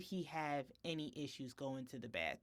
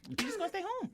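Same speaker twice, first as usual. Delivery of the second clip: exasperated decree; 4 dB louder, faster than usual. Retold or imitated. Mother tells son he stays home.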